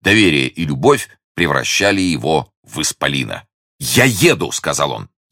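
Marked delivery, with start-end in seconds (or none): 3.8-4.5 higher, eager announcement; proud joy. A narrator's reading. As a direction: declare it with happy resolve. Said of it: "сиял от гордости и счастья".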